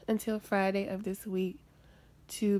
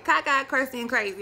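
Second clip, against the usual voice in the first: louder, punchy self-mocking catchphrase; emphatic catchphrase; 4 semitones higher, 7 dB louder than usual. Real, not acted. Vlogger joking about her own looks, emphatic. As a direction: hit each word loudly, with relish.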